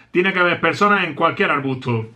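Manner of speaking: normal talking voice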